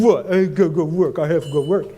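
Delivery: imitated teen grumble in a put-on voice; pouty teenage daughter. Daughter argues back at dad, pouty. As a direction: whine back like a sulky teenager. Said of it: "that's how they talk".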